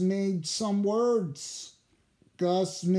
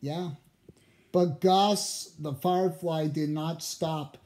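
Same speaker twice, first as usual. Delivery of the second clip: usual speaking voice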